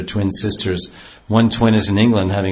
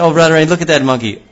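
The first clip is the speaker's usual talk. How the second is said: higher, quick delighted call; excited Krishna; 6 semitones higher, 4 dB louder, faster than usual. Retold or imitated. Krishna calls Radharani to watch the monkey, excited. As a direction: point it out to your beloved, thrilled. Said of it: "and Krishna".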